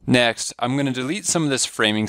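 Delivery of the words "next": ordinary talk, nothing marked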